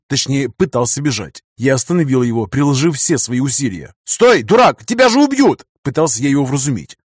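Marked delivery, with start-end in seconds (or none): 4.0-5.6 shouting. A narrator's reading. Stopping friend from running off.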